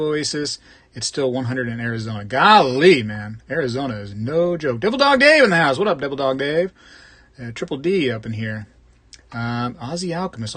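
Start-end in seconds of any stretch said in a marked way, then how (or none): 4.8-6.7 loud, high announcer-style shout-out; hype greeting. Real, not acted. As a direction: call it out like an MC. Greeting a viewer on stream.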